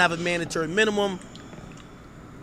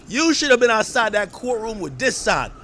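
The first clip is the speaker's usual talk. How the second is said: usual speaking voice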